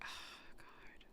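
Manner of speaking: whispered with a sigh; imitated whisper. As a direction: breathe it out as if overwhelmed. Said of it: "and I'm just like".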